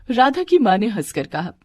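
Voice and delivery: plain reading voice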